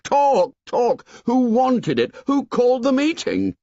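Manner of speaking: high, loud furious yell; angry shout. A narrator's reading. Ralph yelling at the assembly, high and furious. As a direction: hurl the words at them in a rage. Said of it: "shouted Ralph".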